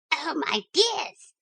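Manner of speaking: high, slow gushing little dog; softly shrill and thrilled; softly shrill excited. Acted. Brenda greets her master and mistress, softly shrill and excited. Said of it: "in a softly shrill, excited voice".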